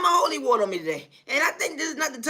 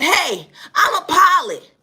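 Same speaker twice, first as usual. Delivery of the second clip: slow, high, louder mock boast of the pilot; 7 semitones higher, 6 dB louder, slower than usual. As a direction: ham up the pilot's proud claim. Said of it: "started to explain that".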